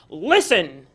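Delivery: loud call for attention; commanding call. Acted. Tiger calling the gathered animals to listen. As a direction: summon the crowd.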